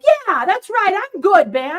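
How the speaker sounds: mock cocky boast; prideful. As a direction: strut a little, acted self-satisfaction. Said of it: "you can pop up to pride".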